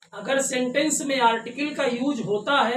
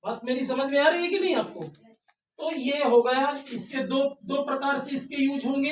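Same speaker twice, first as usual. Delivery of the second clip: ordinary talk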